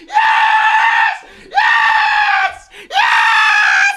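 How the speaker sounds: rising to shrieking high yells of joy; ecstatic screaming. Real, not acted. Fan screaming at his team's third goal, ecstatic shriek. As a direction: lose it completely at the goal. Goal celebration on watch-along.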